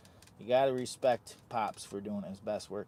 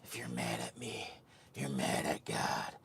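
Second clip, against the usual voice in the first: deep put-on voice; 11 semitones lower than usual. Vlogger playing a preacher for viewers, deep put-on voice. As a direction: drop the voice way down like a prophet.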